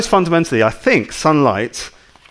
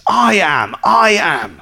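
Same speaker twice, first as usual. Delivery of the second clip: bold claim; 4 semitones higher, much slower than usual. Retold or imitated. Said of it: "he makes enormous claims".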